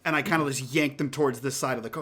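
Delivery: ordinary talk, nothing marked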